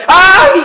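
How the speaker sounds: imitación de un alarido agudo de dolor; grito desesperado imitado. Retold or imitated. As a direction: grita un "ay" agudo, como si te arrancaran la vida. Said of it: "gritando, desesperada, como si le arrancaran la vida". (alarido imitado de parturienta desesperada)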